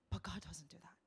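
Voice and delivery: soft whispered point. Real, not acted. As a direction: hush to let it sink in. Whispered emphasis.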